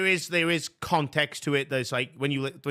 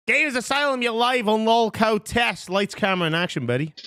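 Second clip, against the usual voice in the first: host announcer; 9 semitones higher than usual. Acted. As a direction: announce the guest like a game-show host. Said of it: "introducing guest on show".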